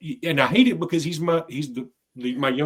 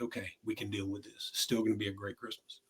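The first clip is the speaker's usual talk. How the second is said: quiet calmed-down reassurance; 6 semitones lower, 15 dB quieter than usual. Retold or imitated. Family calming down in car.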